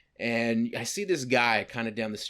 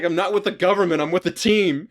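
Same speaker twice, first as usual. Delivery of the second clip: high, raised-voice mock pleading; loud protest; 6 semitones higher, 6 dB louder than usual. Passerby answering the government accusation, loud high protest. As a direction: protest loudly, pitched well up. Acted protest to the goblin man.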